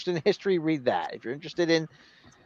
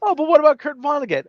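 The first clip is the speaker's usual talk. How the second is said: mock academic girl; 12 semitones higher, 5 dB louder, faster than usual. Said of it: "first she said".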